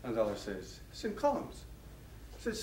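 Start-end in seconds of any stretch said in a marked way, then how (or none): 0.9-1.6 high, soft proud answer; name the school with a lift up high; pleased Irishman. Retold or imitated. Second Irishman answering his new friend, high and pleased. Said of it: "getting more and more excited".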